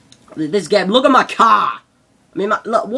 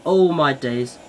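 normal talking voice

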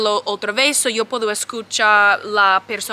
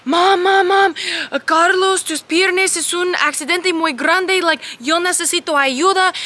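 mucho más agudo y urgente; llama a mamá con prisa y angustia; llamada urgente; 7 semitones higher than usual. Retold or imitated. (hija angustiada llamando a su madre)

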